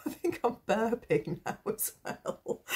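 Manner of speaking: high, giggly, laughing through the words; real helpless giggle while talking; embarrassed laughter. Real, not acted. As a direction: laugh off a burp mid-sentence. Embarrassed laugh after a burp.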